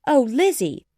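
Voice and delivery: high, loud exclamation falling away; joyful cry. A narrator's reading. Jane embraces her sister, joyful cry. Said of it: "cried Jane".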